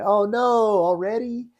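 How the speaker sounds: high, slow, drawn-out groan of disappointment; mocking classmates. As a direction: classmates watching the webcam groan at Jim, mock dismay. Classmates watching him finish early.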